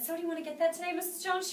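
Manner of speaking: high, unsure pleading question; timid ask. Retold or imitated. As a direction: ask as if expecting a no. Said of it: "don't go like that when you ask for the order".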